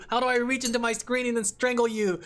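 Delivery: play-furious, as if ready to strangle; mock fury. Real, not acted. Playfully furious at a chat viewer.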